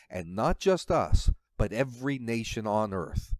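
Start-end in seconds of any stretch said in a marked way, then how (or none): none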